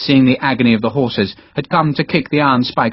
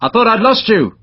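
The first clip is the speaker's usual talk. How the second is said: called out; 10 semitones higher than usual. Acted. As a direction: shout to Harper across the square. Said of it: "he called out to Harper".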